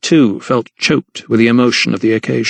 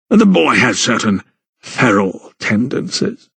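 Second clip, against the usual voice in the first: put-on slow, cold contempt for the boy; a haughty aristocrat sneering at a child; disdainful. A narrator's reading. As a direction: look down your nose and drawl it out. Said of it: "he said disdainfully".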